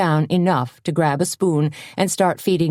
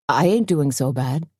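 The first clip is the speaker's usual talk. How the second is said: usual speaking voice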